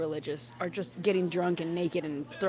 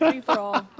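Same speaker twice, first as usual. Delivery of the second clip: laughing; 3 semitones higher, 7 dB louder than usual. Real, not acted. Laughing through a brownie mouthful.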